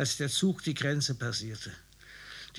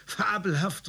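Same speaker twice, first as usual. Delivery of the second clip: higher, a burst of enthusiasm; delighted exclamation; 6 semitones higher than usual. Acted. The officer cries out to the writer, delighted. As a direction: cry out in surprised delight. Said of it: "rief er".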